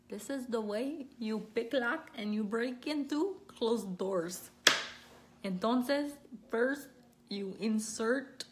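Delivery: put-on slow instructional voice in a Mexican accent. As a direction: play a Mexican how-to instructor, drawing out the words. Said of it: "how to do it Mexican style".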